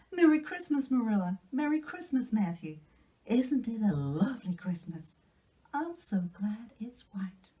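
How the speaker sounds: put-on high, breathless delighted girl; young girl bubbling over with Christmas wonder; excited Anne. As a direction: gush with joy over the gift. Anne thrilled on Christmas morning.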